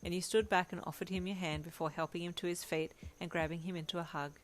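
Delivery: usual speaking voice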